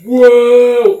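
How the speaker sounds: very high, loud gasp of delight; light up over a single coin; thrilled oaf. Acted. GM as Elmo thrilled by a gold piece, loud.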